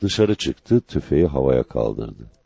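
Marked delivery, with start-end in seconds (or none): none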